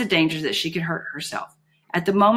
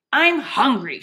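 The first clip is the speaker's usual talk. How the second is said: high-pitched indignant shout, moderately loud; 7 semitones higher, 3 dB louder, slower than usual. A narrator's reading. Holly shouting at her captor for food. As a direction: pitch it up like an angry complaint. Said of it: "shouted Holly".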